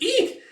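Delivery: extremely high squeal; terrified shriek. Acted. Soldier about to be cut down, high shriek. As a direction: squeal in panic.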